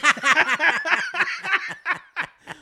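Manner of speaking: high, loud burst of laughter; big laugh. Real, not acted. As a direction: crack up loudly. Laughing at her own reply.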